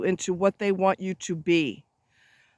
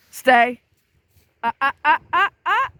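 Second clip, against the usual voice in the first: loud order, then higher warning sounds; urgent dog command; 6 semitones higher, 6 dB louder than usual. Real, not acted. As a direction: stop the dog before it bolts. Stopping dog chasing a car.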